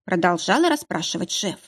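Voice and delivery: ordinary delivery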